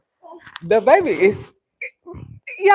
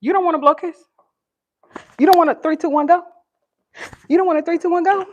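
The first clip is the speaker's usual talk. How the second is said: high, playful countdown coaxing; 9 semitones higher, 5 dB louder than usual. Real, not acted. Mom counting down to get the baby to kiss, high coaxing.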